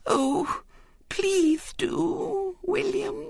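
tiny, very high pleading; slow, tearful little-girl wheedle; Violet Elizabeth, quivering. A narrator's reading. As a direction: plead on the edge of tears. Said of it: "in a quivering voice".

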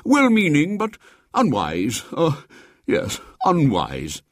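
high then settling pompous judgement; musing bishop. A narrator's reading. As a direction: weigh the word thoughtfully. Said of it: "said the bishop kindly".

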